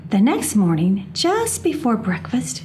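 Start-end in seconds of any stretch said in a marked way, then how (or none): none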